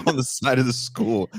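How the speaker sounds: usual speaking voice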